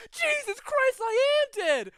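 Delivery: high, very loud shriek of shock; panicked shout. Acted. Dead man scared that he's dead, hammed-up panicked shout. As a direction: scream it in hammed-up horror. Stuck soul panics once more.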